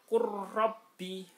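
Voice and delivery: "qurrabbi" recited high and loud with a long rolled r; merged lam into ro. Shown as a demonstration. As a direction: chant "qurrabbi" with no l and a doubled trilled r. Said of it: "Lamnya dihilangkan, roknya ditasdidkan".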